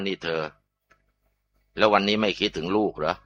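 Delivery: soft, voiced half-whisper from the husband; hushed question. Husband quietly asks his wife about their son, hushed. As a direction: ask gently under the breath. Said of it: "ตาผัวก็กระซิบถามว่า".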